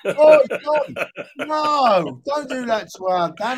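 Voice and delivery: sudden high, loud mock alarm. Teammate pretending panic as Dan's feed drops, high and loud.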